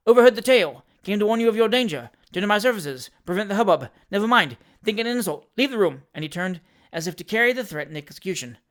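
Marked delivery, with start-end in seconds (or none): 0.1-6.0 high, loud staccato patter; Jingle, an unabashed schemer. A narrator's reading. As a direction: rattle off excuses in quick bursts.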